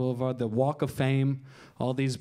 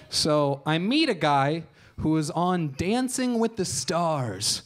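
ordinary talk

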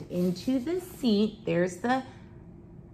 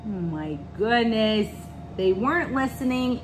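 ordinary delivery, nothing marked

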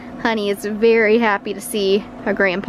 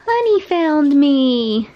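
dog-talk voice; 6 semitones higher, slower than usual. Real, not acted. Greeting dog.

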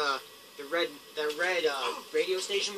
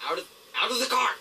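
louder, faster, higher urging; urgent shout; 5 semitones higher, 4 dB louder, faster than usual. Real, not acted. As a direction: call it out quick and urgent. Repeats the line louder.

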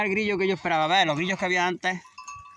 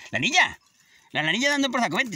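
sharp raised scolding call; shouting at the dog to come; 7 semitones higher than usual. Real, not acted. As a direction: tell the dog off from afar.

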